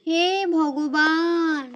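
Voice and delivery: slow, drawn-out cry to God; despairing sigh. Acted. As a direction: lament to heaven, slowly. Wife despairs at wrong vegetables. (ধীরে টেনে হতাশ আর্তি)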